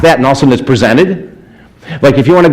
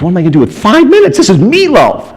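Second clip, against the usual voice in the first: higher, quicker rush of awe; excited disbelief; 8 semitones higher than usual. Retold or imitated. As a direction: starstruck panic at five minutes. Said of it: "awed at meeting [name]".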